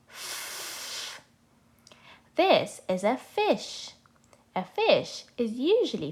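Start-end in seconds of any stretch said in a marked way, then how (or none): 0.1-1.2 a long hissed "F" sound, teeth on the lip; phonics sound demo. Shown as a demonstration. Teacher showing kids the "F" sound, slowly drawn out. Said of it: "they make the sound".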